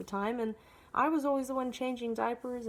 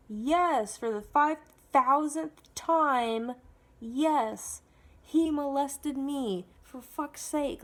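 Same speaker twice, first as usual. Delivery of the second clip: loud, slow, drawn-out emphasis; re-enacted fed-up outburst, each phrase stretched; exasperated insistence; 3 semitones higher, 6 dB louder, much slower than usual. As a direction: spell it out for someone who won't listen. Retold exasperated answer to mother.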